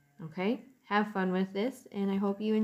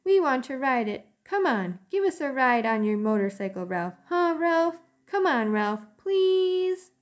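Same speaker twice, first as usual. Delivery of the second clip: high, louder pleading little mice; begging kids; 7 semitones higher, 5 dB louder than usual. Acted. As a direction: nag him for a ride. Cousins begging Ralph.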